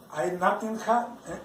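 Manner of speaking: ordinary talk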